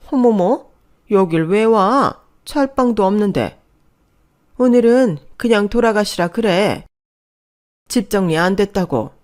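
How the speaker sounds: loud, high-pitched disapproval; displeased surprise. Acted. Mother-in-law wants the visitor sent away, displeased. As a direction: balk loudly at the unwelcome guest.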